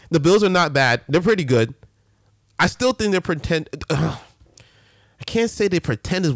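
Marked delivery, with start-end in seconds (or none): none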